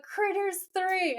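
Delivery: high, drawn-out echo of the film title; imitated exclamation. Actor echoing a fan's mention of his film, mock exclamation. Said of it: "And Jared's like".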